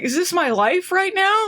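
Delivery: high-pitched mock disbelief; incredulous dismay. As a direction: imitate someone baffled by their own bad day. Death voiced in disbelief.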